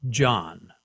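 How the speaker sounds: "John" said with an English J; English pronunciation. Shown as a demonstration. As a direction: say "John" as one English syllable. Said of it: "we pronounce that name".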